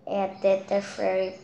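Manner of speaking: ordinary delivery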